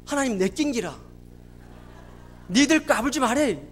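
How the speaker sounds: punchy Busan-accented declaration; Busan dialect. Shown as a demonstration. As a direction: say it as a defiant Busan local would. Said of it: "부산말로 어떻게 표현하는지 아십니까?".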